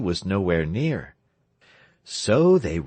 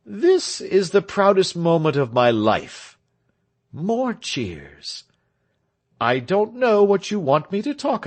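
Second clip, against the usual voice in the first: higher, louder speech-making voice; proud orator; 7 semitones higher, 4 dB louder than usual. A narrator's reading. Tell making his first speech to the crowd, proud orator.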